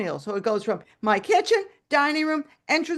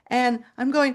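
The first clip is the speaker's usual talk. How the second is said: ordinary delivery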